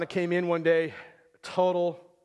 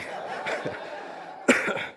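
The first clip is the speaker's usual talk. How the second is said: long breathy unvoiced laughter; real laugh. Real, not acted. Laughing after joke prayer.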